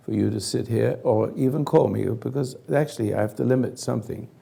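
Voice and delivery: ordinary talk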